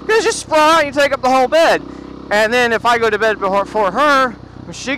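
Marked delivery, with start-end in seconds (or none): 0.1-1.8 loud, high comeback in a retold spat; raised-voice husband's complaint; exasperated retort. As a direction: fire back in mock exasperation. Acted quarrel with wife.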